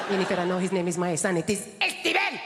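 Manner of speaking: put-on Spanish-accented mum, rising on "Esteban"; indignant Spanish mum. Mum insisting to her daughter on the name, Spanish accent. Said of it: "my mum".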